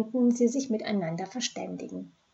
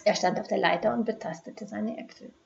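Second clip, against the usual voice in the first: ordinary delivery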